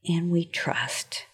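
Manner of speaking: soft, slow hushed whisper. Acted. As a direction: breathe the last words gently. Ending advice, whispered.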